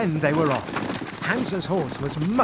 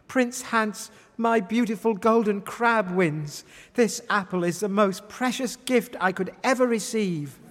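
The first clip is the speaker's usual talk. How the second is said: excited Rose; 7 semitones higher than usual. Acted. Rose declaring her love.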